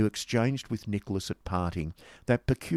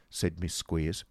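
ordinary delivery, nothing marked

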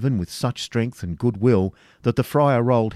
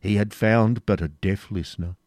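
ordinary delivery

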